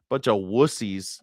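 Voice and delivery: usual speaking voice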